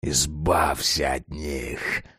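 deep, slow hiss; low drawn-out menacing hiss; hissing vampire. Acted. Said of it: "прошипела окровавленная пасть Росса".

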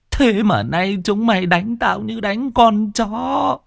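high, slow, tearful outburst; old cook wailing at her cruel masters. A narrator's reading. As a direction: pour out years of grievance in tears. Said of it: "bà mếu máo".

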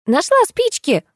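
put-on very high excited girl's squeal. A narrator's reading. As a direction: squeal in triumph like a child. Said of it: "взвизгнула Кристина". (радостный визг девочки)

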